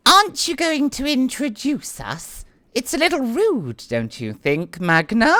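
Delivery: high, coy noblewoman's voice, gently teasing; a lady mildly chiding Magna; Annette's voice. Acted. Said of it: "is gonna be like".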